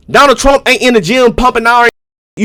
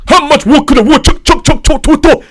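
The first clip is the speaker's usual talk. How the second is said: high, cotton-mouthed British mimicry; mock British tongue-twister, pitched up; mock British accent; 6 semitones higher than usual. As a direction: make fun of a mumbled British accent. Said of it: "talk like y'all got fucking cotton in your mouth".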